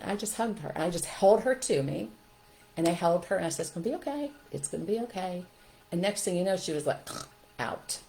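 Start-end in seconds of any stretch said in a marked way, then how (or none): 3.7-5.4 put-on soft, hushed comfort to a child; softly calm someone down; soothing reassurance. Mother hugging her crying daughter, softly reassuring.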